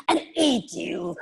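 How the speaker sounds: slow, gloating witch with cackles; creepy witch. Acted. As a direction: savor the threat slowly. Witch threatens old One Eye.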